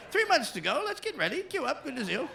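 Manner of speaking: very high, chirpy mock English accent; English accent babies. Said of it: "They're like".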